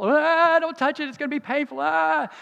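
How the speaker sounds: put-on high, loud whining child voice; whiny little girl. Father imitating his daughter begging not to touch, high whine. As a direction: imitate a scared four-year-old pleading. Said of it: "she was all".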